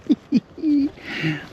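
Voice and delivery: high breathy giggle in the dark; nervous giggle. Real, not acted. Nervous laugh in dark crypt.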